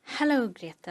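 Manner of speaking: normal talking voice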